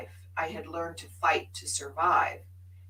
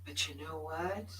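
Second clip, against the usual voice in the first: normal talking voice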